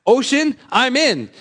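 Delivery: high, eager burst of enthusiasm; sudden eager yes, voice jumping way up; excited. Pretend. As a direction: blurt it out like you can't wait. Eager listener imagined jumping in.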